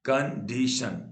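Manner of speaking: Condition slowly broken into three beats; syllable by syllable. Shown as a demonstration. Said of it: "तीन बार टूटा".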